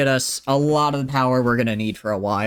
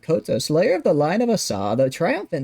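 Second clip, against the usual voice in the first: grand, rushed title recital, higher and louder; all in one breath; 6 semitones higher, 3 dB louder than usual. Pretend. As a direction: rattle off the royal titles without a breath. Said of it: "do it all in one breath".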